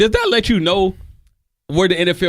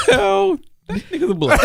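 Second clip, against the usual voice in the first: high laughing movie-line voice; imitating Stacy; 14 semitones higher than usual. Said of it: "Remember that talk we had?".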